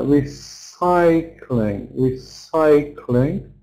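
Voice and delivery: "recycling" slowly, re-CY-cling with a long CY; second-syllable stress. Shown as a demonstration. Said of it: "on the second syllable?".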